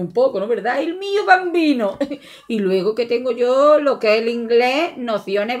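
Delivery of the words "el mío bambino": lightly hammed-up Italian, pitched higher; a playful hint of an Italian accent; mock Italian. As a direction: show off a bit of fake Italian. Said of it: "lo que es el italiano, que también lo domino".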